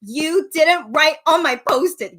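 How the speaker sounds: slow, loud call across the room; shouting. Retold or imitated. Fan shouting at idol from her seat. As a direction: call out to him from the seats. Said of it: "So I shouted to him in English".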